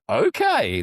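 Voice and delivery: high, loud, startled okay; taken aback. Retold or imitated. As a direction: act out being thrown by a hostile reply. Retold startled reaction to hostility.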